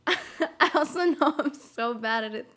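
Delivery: talking through a laugh; laughing admission. Real, not acted. Streamer admitting they're bad at it, laughing. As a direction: let giggles run into the words. Laughing at own attempted accent.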